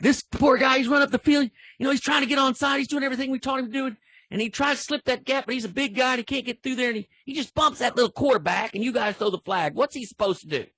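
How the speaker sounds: high-pitched rush of pleading protest; animated, excited. Retold or imitated. Coach protesting a flag to the referee, animated and excited. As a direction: argue the player's case in a high, agitated voice. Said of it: "he's animated and excited".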